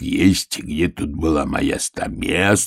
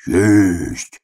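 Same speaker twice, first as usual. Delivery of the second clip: hare's muffled voice. Retold or imitated. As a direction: sound dull as if from a barrel. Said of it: "глухим голосом зайца сказал".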